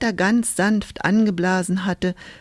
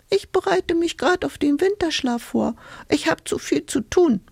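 much higher busy little creature; hedgehog voice; 10 semitones higher than usual. A narrator's reading. Hedgehog preparing for winter sleep, high and dismissive. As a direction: brush her off as a fussy hedgehog.

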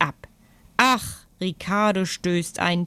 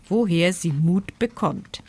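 usual speaking voice

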